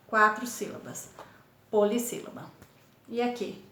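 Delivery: ordinary talk, nothing marked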